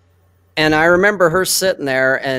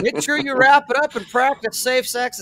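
high mocking imitation of a fussing buddy; mock nagging friend; 9 semitones higher than usual. Friend mocking a buddy's nagging: high mocking imitation. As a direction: parody a nagging pal, pitched up.

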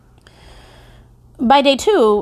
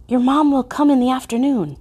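usual speaking voice

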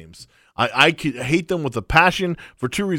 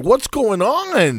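confused quote; 9 semitones higher, much slower than usual. Retold or imitated. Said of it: "I'm like".

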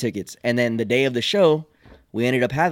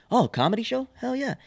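curious passerby; 12 semitones higher than usual. Retold or imitated. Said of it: "they're gonna get curious".

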